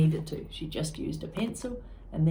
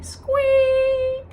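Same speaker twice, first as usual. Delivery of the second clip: shrill, loud mock squeal; squeak; 10 dB louder than usual. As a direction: imitate a loudspeaker squeak. Loudspeaker squeal.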